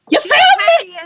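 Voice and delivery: high, puzzled voice of the woman in the bushes; a high, confused "you found me?"; dazed stranger. Retold or imitated. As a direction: squeak it out like you've just been discovered. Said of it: "She done said".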